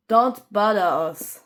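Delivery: ordinary delivery, nothing marked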